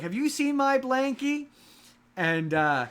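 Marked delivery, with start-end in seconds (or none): 0.0-1.5 put-on much higher, sweet little-kid voice; baby dinosaur voice. Baby dinosaur asking the audience about her blankie, high and childlike. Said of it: "talks to the audience like".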